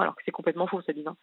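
normal talking voice

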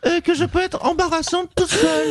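Nathalie Loiseau impression. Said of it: "Bonjour Nathalie Loiseau".

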